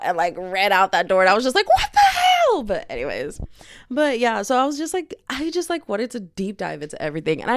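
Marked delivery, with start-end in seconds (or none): 1.7-2.6 high, loud shocked shriek; retold disbelief, shot way up high and loud; shocked outburst. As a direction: blurt it in piercing disbelief. Acts her shocked reaction to breakup.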